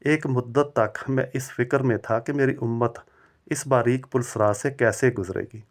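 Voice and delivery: usual speaking voice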